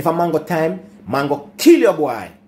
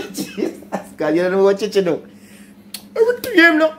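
laughing; 12 semitones higher than usual. Real, not acted. Laughing while retelling prank.

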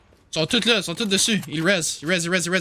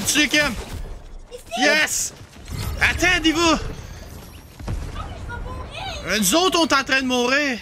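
panicking gamer; 10 semitones higher than usual. Real, not acted. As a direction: yell in a frantic rush. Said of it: "Calm down".

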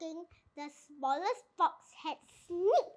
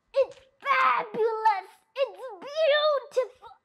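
rapturous; 6 semitones higher, 4 dB louder than usual. A narrator's reading. Mister Fox delighted by cider.

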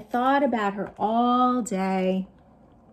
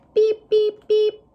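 high, bright beeping robot; robot beeps; 12 semitones higher, 8 dB louder than usual. Acted. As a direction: beep like a cartoon robot. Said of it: "familiar robot sounds".

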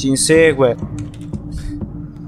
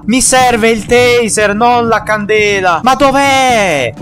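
frantic frustrated yell; 5 semitones higher, slower than usual. Real, not acted. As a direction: shout in frustration searching. Frantic search for taser.

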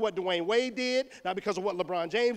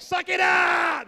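higher, loud and slow "Suck it up!"; mock tough yell; 9 semitones higher, 6 dB louder, much slower than usual. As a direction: bark it like a heartless dad. Yelling at crying son.

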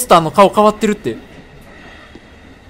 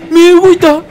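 loud, high alarmed outburst; alarmed cry; 8 semitones higher, 7 dB louder, slower than usual. Real, not acted. Streamer to viewers as the eyes move, alarmed cry.

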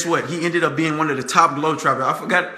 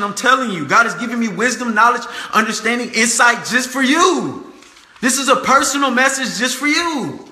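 raised pitch, driving preacher's emphasis; fervent preaching; 6 semitones higher than usual. Real, not acted. Speaker tells viewers God's message is for them, fervent preaching. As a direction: preach it fervently, drawing out the closing words.

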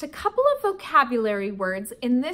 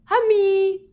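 put-on high, louder little-boy cry of Hummy; toddler voice; 6 semitones higher, 7 dB louder than usual. Toddler repeats the name to big sister, high and loud. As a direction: squeal it like a happy toddler. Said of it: "he said".

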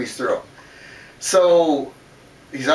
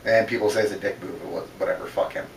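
ordinary delivery

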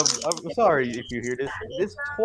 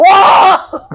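high loud startled yelp; shocked shout; sudden yelp; 14 dB louder than usual. Real, not acted. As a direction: burst out with a shocked cry. Startled outburst in banter.

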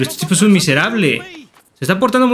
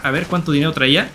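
normal talking voice

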